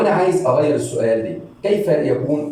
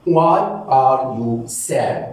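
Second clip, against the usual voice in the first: very slow, each word separated; teacher spelling out a phrase slowly; 3 semitones lower, much slower than usual. Shown as a demonstration. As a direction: break the question into single slow words. Repeated word by word for learners.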